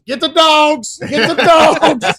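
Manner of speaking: high, loud imitated panic; panicked yell. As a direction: yell it like a hammed-up rescue order. Imitates panicked first reaction after rollover.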